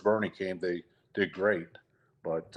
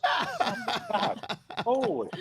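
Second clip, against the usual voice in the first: laughing disbelief; 12 semitones higher, slower than usual. Real, not acted. Laughing at the memory.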